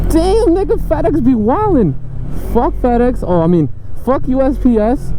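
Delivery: high-pitched exasperated outburst; mock outrage. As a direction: rant in a squeaky, exaggerated high voice. Mock rant at delivery companies.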